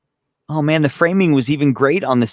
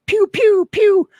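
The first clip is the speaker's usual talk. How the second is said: high, squealed gunshot pews; imitated "pew pew" gunfire; mouth-made bullet sounds; 5 dB louder, slower than usual. Shown as a demonstration. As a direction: fire off cartoon shots with your voice. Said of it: "Just make the bullet sounds".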